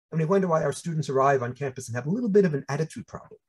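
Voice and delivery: ordinary talk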